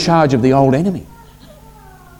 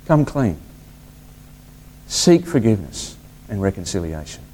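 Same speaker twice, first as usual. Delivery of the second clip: soft, lower, tender call; gentle invitation; 6 semitones lower, 5 dB quieter than usual. Acted. Preacher voicing Jesus calling the weary, soft and tender. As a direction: invite them softly, like a shepherd.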